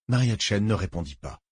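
plain reading voice

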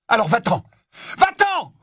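high, loud shout building on the repeat; roaring. A narrator's reading. Harry orders Ron to leave, roaring in fury. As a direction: roar the order, harder the second time. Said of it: "rugit Harry".

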